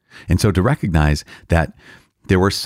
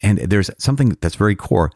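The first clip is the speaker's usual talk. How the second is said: ordinary delivery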